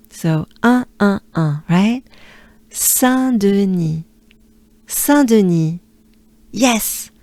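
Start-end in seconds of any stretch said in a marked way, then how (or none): 2.7-5.8 Saint-Denis slow, each syllable clear; Saint-Denis repeated for a class to copy; Saint-Denis said slowly and clearly; slow French Saint-Denis. Shown as a demonstration. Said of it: "We take a good breath".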